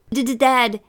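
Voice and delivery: high, loud stammer on the first sound; nervous stutter. A narrator's reading. Boy caught out by his father, panicked stutter. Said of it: "Eric stuttered".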